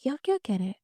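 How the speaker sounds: soft, hushed question; softly spoken. A narrator's reading. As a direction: ask it quietly, under the narration. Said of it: "وہ دھیرے سے بولی".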